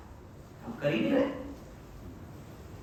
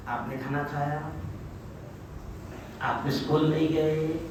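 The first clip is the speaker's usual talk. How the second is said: put-on much softer, lower polite question to a child; polite and respectful; 5 semitones lower, 9 dB quieter than usual. Father speaks respectfully to his child, soft polite tone. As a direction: ask it gently and respectfully. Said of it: "بات ادب سے کرے گا".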